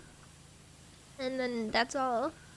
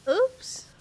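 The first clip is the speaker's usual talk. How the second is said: high, loud startled oops; surprised oops; 10 semitones higher, 6 dB louder than usual. Real, not acted. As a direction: yelp it out in surprise. Sudden mishap in game.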